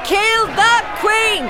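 shouting. Acted. Shouting in crowd disguise.

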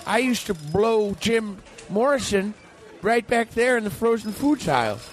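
high, slow reminiscing character voice; lost customer. Acted. Lost retired shopper reminiscing in the store, high slow voice. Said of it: "I'm another customer".

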